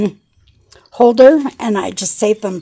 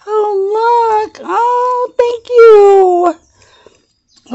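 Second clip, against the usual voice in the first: very high, drawn-out squeal of thanks; overcome with delight; 12 semitones higher, 4 dB louder, much slower than usual. Real, not acted. Crafter finding charms in a friend's gift, squealing thanks. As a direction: coo high and long, almost crying with gratitude.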